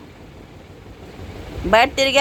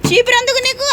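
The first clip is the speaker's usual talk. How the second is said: high surprised squeal; 12 semitones higher, 3 dB louder than usual. Real, not acted. Dog grabs the broom.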